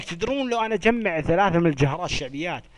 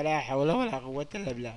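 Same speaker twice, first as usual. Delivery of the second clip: usual speaking voice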